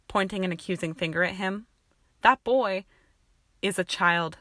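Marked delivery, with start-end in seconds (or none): 2.2-4.4 interrupting. A narrator's reading. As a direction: cut in forcefully, then ease off. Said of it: "Snape interrupted".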